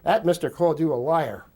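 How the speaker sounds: imitating instructors baiting a fellow officer; stirring-up taunt. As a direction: egg the instructor on. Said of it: "all the other instructors said".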